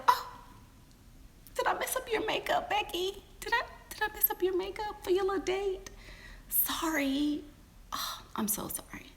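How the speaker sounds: high, whispered fake-sweet apology; mock sorry. Wife taunting the mistress about her makeup, mock-sweet.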